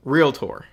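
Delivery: "realtor" said in two syllables, REEL-tor; correct pronunciation. Shown as a demonstration. Instructor teaching students the right word, clear two-syllable REEL-tor. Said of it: "It is, in fact, pronounced".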